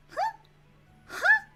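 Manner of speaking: high-pitched mock surprise, rising sharply; surprised huh. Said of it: "He's so surprised".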